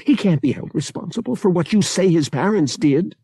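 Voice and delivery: high, insistent plea; fighting tears. A narrator's reading. Bria defending Han to her brother, fighting tears. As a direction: plead his case, holding back tears. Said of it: "fighting not to give in to tears".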